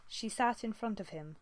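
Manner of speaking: ordinary delivery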